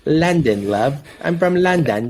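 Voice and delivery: a hint of a British movie quote; British accent. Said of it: "Forgetting Sarah Marshall?".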